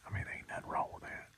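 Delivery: soft hushed whisper. Real, not acted. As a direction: whisper so the deer won't hear. Whispered hunting vlog in stand.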